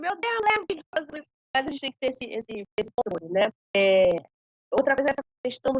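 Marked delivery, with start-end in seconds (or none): 0.0-1.3 exasperated exclamation. Retold or imitated. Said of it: "Então, eu falo".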